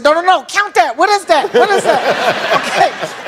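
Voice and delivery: high, laughing protest; high and flustered, then slow; frantic protest. Retold or imitated. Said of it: "I said".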